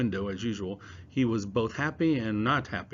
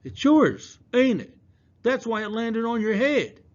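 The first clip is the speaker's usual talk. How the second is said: accusing; 13 semitones higher, 8 dB louder than usual. Acted. Kid accusing Palmer.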